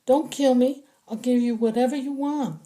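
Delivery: high, frightened begging for life; a captive begging the soldiers to spare him; pleading. Acted. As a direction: beg him not to shoot. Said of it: "the man pleaded".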